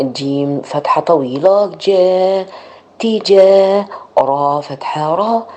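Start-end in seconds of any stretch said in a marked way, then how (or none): 3.0-3.9 تجا stretched, high and slow, the long a held; blending syllables. Shown as a demonstration. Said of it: "blending syllables slowly".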